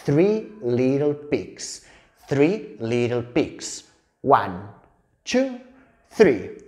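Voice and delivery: very slow, drawn-out English words; slow, rhythmic picture-book chant; storytelling for toddlers. Shown as a demonstration. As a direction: count it out slowly for a tiny child. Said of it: "os voy a enseñar un poco cómo lo cuento yo".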